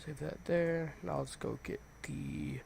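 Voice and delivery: normal talking voice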